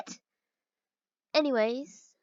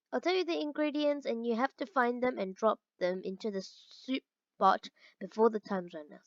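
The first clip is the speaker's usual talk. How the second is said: normal talking voice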